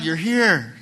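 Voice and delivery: loud relieved cry. Retold or imitated. Parents finding Jesus, crying out loud. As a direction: let the relief burst out. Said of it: "crying out loud".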